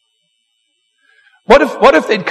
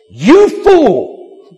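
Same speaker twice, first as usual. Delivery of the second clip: stern God; 3 semitones higher, 3 dB louder than usual. Retold or imitated. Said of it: "God talks like this".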